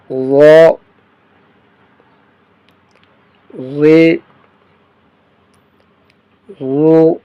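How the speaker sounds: و، وی، وو said thick and full in the mouth; heavy letter. Shown as a demonstration. Teacher showing students the heavy letter, thick full syllables. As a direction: make each syllable heavy and rounded. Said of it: "موٹا کر کے پڑھیں گے و".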